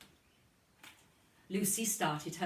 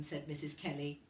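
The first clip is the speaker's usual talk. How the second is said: usual speaking voice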